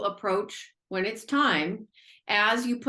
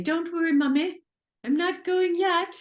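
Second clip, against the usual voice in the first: put-on English accent; 10 semitones higher, 4 dB louder than usual. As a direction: gently voice the animal in an English accent. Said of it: "Like your English accent".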